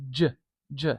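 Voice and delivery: j as a buzzing zh-like sound; isolated j sound. Shown as a demonstration. Teacher sounding the j symbol alone, buzzy. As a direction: sound out j on its own. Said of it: "one of the fancier looking symbols".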